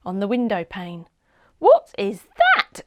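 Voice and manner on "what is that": high, slow, loud puzzled question; startled girl wondering. A narrator's reading. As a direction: wonder aloud at the noise. Seb wakes puzzled at night.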